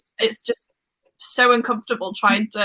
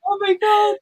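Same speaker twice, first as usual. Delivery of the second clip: excited shout; 10 semitones higher than usual. Real, not acted. Laughing at India's reaction in the video, shouting. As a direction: burst out at the funny reaction.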